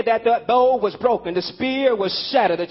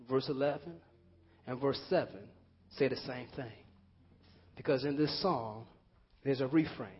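much softer, lower and unhurried; gentle reflective preaching; 5 semitones lower, 4 dB quieter than usual. Acted. Preacher guides the congregation to let go, softly. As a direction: ease the room into letting go.

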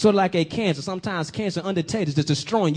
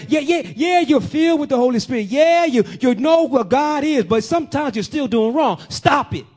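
higher and louder preaching; rising preaching fervor; 4 semitones higher, 3 dB louder than usual. Real, not acted. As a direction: build the fire and punch it home. Preacher building to climax.

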